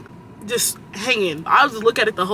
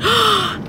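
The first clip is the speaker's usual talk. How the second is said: sharp gasp; 11 semitones higher than usual. Real, not acted. Spots pretty sky while driving.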